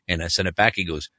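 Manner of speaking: ordinary talk, nothing marked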